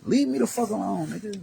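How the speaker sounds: high, strained mock-cop voice; the cop who finally gets it, in a high rattled voice. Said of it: "And they go".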